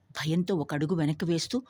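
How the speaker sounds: ordinary delivery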